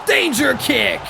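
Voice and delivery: "Danger kick" yelled high and loud; hype shout. Real, not acted. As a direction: call the move out like an announcer. Hyping up his finisher move.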